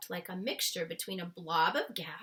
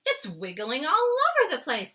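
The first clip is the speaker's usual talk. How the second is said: put-on higher, loud alarmed little girl; excited cry; 8 semitones higher, 10 dB louder than usual. Sophie cries out at the jar, high and alarmed. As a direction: Sophie shouts watching the jar. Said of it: "Sophie cried".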